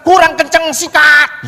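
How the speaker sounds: high, loud shouts to speed on. Retold or imitated. Anton on the back seat yelling at the rider, loud and high. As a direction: urge the rider on hard.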